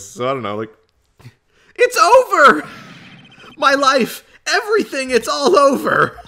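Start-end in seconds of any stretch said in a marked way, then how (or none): 1.8-6.2 really whiny, high and loud wail; Larry Butts. Acted. Despairing client screaming in court lobby, whiny voice. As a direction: wail hysterically in a hammed-up whine. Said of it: "like, a really whiny voice".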